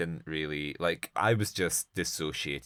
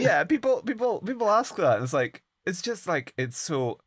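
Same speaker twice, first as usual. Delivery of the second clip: much higher, louder sarcastic lament; hammed-up weary victim; mock sigh of a burdened man, high; mock put-upon man; 12 semitones higher, 4 dB louder than usual.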